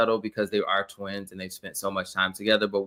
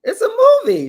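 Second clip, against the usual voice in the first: loud, high-pitched exclamation; exasperated protest; 10 dB louder, faster than usual. Real, not acted. Co-host defending a vampire movie scene, exasperated. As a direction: burst out in protest, voice leaping up.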